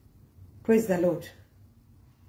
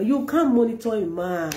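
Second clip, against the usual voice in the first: ordinary delivery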